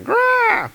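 high-pitched mock roar; monster roar. Manticore attacking woman, mock monster roar. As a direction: growl it out like a beast.

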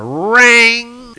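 high, loud, drawn-out clang; clanging sound effect. Acted. Spear hitting Thor over the head, long loud clang. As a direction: ring it out long like struck metal.

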